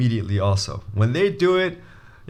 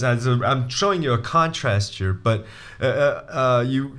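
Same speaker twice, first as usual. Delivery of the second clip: normal talking voice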